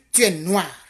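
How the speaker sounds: normal talking voice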